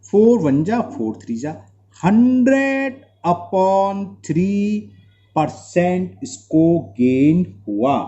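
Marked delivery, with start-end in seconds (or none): 2.0-7.9 slow dictation. Real, not acted. Teacher states result slowly.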